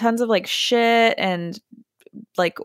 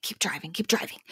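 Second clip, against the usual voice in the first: hushed urging; 10 dB quieter, faster than usual. Retold or imitated. Driving past the owner unseen.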